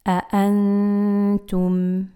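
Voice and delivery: أأنتم with each hamza stopped at the vocal cords; clear hamzas. Shown as a demonstration. معلم ينطق أأنتم بوضوح كل همزة. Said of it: "Make sure that you're pronouncing each hamza".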